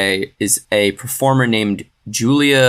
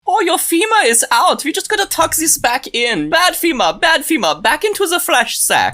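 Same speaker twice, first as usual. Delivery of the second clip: hammed-up, very high Swiss German accent; Swiss German surgeon accent. Surgeon tucking a bone back in, hammed-up Swiss German accent. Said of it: "that kinda interesting Swiss German accent".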